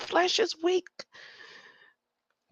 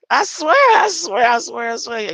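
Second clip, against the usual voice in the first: high, loud repeated swearing; excited insistence; 5 semitones higher, 11 dB louder than usual. Real, not acted. Insisting to viewers, excited and loud. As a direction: plead it, louder and higher.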